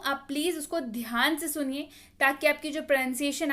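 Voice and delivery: ordinary talk, nothing marked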